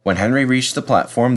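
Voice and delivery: ordinary delivery, nothing marked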